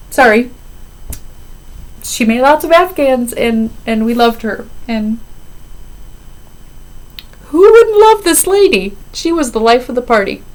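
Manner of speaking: choked up and fighting back tears; crying. Real, not acted. Remembering her late mother-in-law, real crying. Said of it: "Sorry, I didn't mean to cry".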